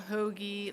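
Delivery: "hoagie" with a long, drawn-out Delco O. Shown as a demonstration. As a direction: stretch the O in "hoagie". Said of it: "you draw out your O's".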